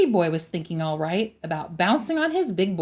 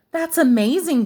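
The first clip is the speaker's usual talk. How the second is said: put-on high-pitched girlish gush; storybook girls marveling, pitched up; 7 semitones higher, 4 dB louder than usual. A narrator's reading. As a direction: gush with admiration. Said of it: "gushed the girls".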